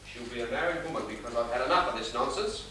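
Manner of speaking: low, fast grumble under the breath; muttered aside. Acted. Father grumbling to himself outside the bathroom, muttered.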